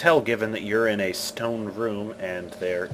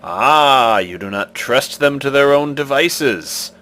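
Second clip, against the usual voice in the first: commanding stone guardian, louder; stone face voice; 5 semitones higher, 8 dB louder than usual. Acted. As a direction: demand an answer like an ancient idol.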